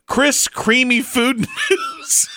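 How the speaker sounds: high, slow, laughing read-out; losing it while reading aloud; cracking up. Real, not acted. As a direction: break into laughter before the last word. Cracking up reading listener's text.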